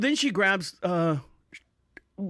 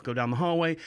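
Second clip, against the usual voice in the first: usual speaking voice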